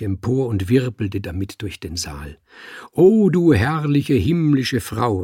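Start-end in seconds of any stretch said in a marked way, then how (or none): 2.9-5.2 slow, loud, swooning declamation; rapturous exclamation. A narrator's reading. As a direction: adore her in grand, drawn-out cries. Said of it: "rief er".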